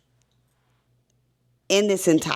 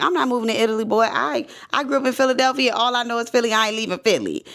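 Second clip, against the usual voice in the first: higher, faster mock mother protesting; imitating stubborn mom; 7 semitones higher, much faster than usual. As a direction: play the Philly mom who won't budge, pitched up. Son quotes his stubborn mom.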